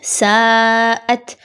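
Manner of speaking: ساءت with the vowel stretched long; long madd. Shown as a demonstration. Teacher showing how to stretch the madd, drawn out. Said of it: "مد کو کھینچ کے".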